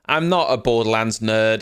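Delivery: ordinary delivery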